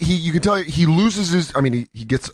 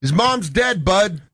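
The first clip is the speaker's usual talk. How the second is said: higher, light Canadian heckler call; polite Canadian heckle; 4 semitones higher, slower than usual. Retold or imitated. Comic quoting the Canadian heckler, polite Canadian call. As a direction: toss it off like a friendly Canadian heckler. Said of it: "in a very polite Canadian way go".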